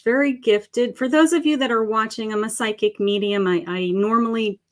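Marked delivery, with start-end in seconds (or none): none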